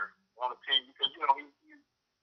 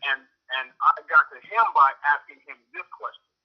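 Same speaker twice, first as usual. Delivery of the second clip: usual speaking voice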